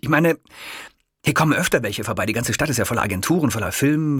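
eager, excited kiosk man. A narrator's reading. Kiosk man pitching agencies to Hitler, eager and fast. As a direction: rush it out, thrilled by the discovery. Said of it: "eiferte der Krämer".

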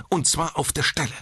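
high, emphatic command; insistent nurse. A narrator's reading. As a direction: demand it at once, firmly. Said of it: "beharrte die Schwester". (mit strengem Nachdruck)

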